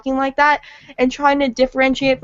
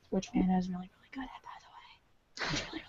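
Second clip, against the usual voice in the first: whispered aside; 6 semitones lower, 19 dB quieter than usual. Real, not acted. Whispered aside praising Anna.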